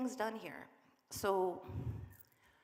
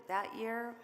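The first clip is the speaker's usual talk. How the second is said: usual speaking voice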